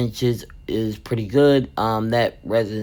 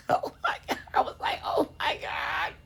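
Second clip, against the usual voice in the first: breathless laughter through the words; wheezing laugh; 10 dB quieter than usual. Real, not acted. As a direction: laugh helplessly while speaking. Laughing at son's outburst.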